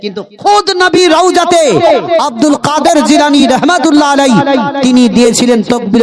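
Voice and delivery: high, ringing sermon voice; full-force preaching. Acted. Preacher asserting the slogan's history at the tomb, high forceful preaching.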